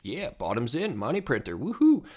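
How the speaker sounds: giddy cheer that jumps to a loud high woohoo; excited inner cheer. Retold or imitated. Trader reliving his bottom call, cheering loudly. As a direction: cheer like you just called the bottom. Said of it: "and I'm thinking".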